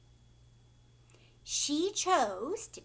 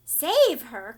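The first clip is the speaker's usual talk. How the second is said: loud, desperate cry; 2 semitones higher, 12 dB louder than usual. A narrator's reading. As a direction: cry out in panic to save her. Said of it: "cried Missus Beauregard".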